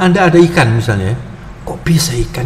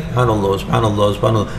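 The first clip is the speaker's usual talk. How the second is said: softer, hurried "Subhanallah" rattled off by habit; rote recitation; 7 semitones lower, 6 dB quieter, faster than usual. Shown as a demonstration. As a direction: repeat "subhanallah" mechanically, without thought. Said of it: "Kalau Anda melafalkan saja".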